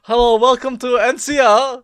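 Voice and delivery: high, loud put-on Russian accent; a joking Russian welcome for a friend; mock Russian greeter. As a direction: greet like a Russian game character.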